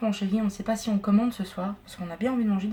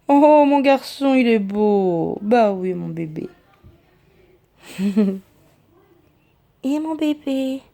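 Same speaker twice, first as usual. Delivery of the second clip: slow, louder doting coos; 6 dB louder, much slower than usual. Real, not acted. She fusses over her cat Winston, slow doting baby talk. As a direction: gush over your pet, drawing the words out.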